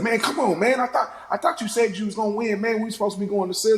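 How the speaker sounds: high, fast whiny complaint; mock whine. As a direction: whine it up high like a sore loser. Quoting angry character after loss.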